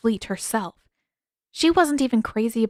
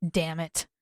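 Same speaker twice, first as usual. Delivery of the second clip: ordinary delivery, nothing marked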